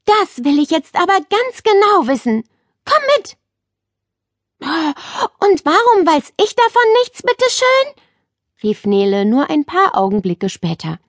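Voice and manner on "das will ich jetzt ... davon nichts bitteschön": put-on high, urgent girl's voice rising to indignation; a girl demanding to know more; eager girl. A narrator's reading. Nele excited, then indignant.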